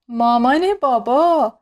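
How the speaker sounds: slow, drawn-out happy cry; delighted exclamation. A narrator's reading. Little millipede's eyes sparkle at grandma, delighted exclamation. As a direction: stretch the words in childlike delight.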